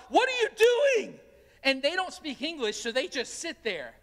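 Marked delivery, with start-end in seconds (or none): 0.1-1.2 much higher, louder alarmed yell at the strangers; startled yell. Retold or imitated. Pastor retelling his friend yelling at men in his truck, startled yell. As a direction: yell it out in shock, as the retold friend. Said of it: "And so he just yells".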